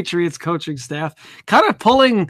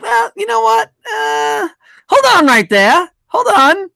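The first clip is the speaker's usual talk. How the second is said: mock second thoughts; 14 semitones higher, 4 dB louder than usual. Kraft and Belichick halting the coach's exit, high mock objection. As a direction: put the brakes on in a high voice. Acting out owner's sudden change of mind.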